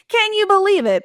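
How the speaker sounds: high-pitched, exasperated outrage; hammed-up incredulous complaint; mock ranting. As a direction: burst in indignant, imitating a colleague mid-rant. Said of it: "on his rant".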